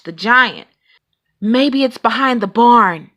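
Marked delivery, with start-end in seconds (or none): none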